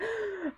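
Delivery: sharp, quiet gasp of alarm. Retold or imitated. To the doctor, a quick scared gasp. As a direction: suck in a quick alarmed breath. Telling the doctor her fears.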